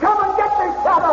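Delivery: high mocking taunt thrown back. Fleeing Whittington taunts the invisible Shadow, high mocking. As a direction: dare him to chase you. Villain taunts while escaping.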